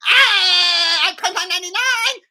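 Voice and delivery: very high screaming mock impression; Alex Stein. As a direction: shriek it like an attention-starved clown. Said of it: "you do this".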